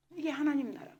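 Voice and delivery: ordinary delivery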